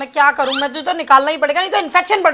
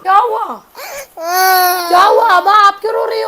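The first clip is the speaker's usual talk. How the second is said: higher, louder, anxious mother's cry; alarmed worried call; 6 semitones higher, 4 dB louder than usual. Acted. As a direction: rush in panicked over the child. Mother alarmed at crying child.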